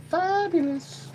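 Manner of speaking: high, dainty fabulous voice; a hint of a gushing diva; put-on fabulous voice. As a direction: gush it like a diva.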